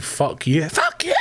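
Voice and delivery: snapped out, pitch leaping very high; sudden scream. Retold or imitated. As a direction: bark it mid-sentence as if startled. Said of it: "I just screamed".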